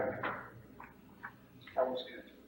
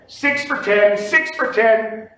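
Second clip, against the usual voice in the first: loud, raised pit call; yelled bid; 5 semitones higher, 7 dB louder, much slower than usual. Shown as a demonstration. Trader practicing bids alone at home, yelling. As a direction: yell it out, higher and steady. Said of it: "and you yell it".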